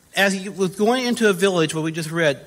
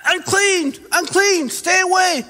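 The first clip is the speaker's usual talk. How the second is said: much higher, put-on calling voice; acted warning cry; 10 semitones higher, slower than usual. Preacher imitating lepers warning Jesus away, high acted cry. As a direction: call out high to warn someone off. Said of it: "They weren't saying".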